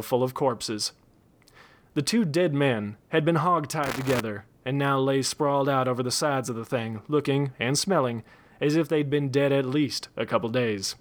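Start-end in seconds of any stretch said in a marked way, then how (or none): none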